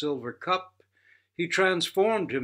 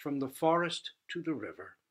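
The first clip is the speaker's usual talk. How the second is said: usual speaking voice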